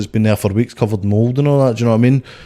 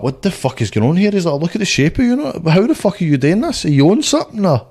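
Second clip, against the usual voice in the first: incredulous manager; 8 semitones higher than usual. Retold or imitated. Manager baffled by weight loss.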